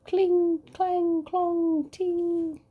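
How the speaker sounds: high ringing clangs; clanging swords. Acted. Sword noises.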